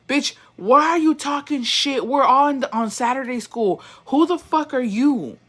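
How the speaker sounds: mock showdown with a bully; indignant confrontation. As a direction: call him out with indignant attitude. Telling off the movie's bully.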